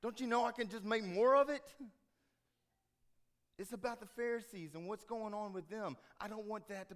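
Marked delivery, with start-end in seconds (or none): 0.0-1.8 high and loud, fed-up insistence; exasperated Jesus. Acted. Jesus to his disciples, loud and exasperated. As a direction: shake them out of it, loudly. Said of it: "Jesus looks at his disciples and says".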